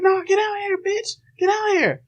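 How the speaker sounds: very high mock grandma scream. Grandma screaming from the sidewalk, high mock shriek.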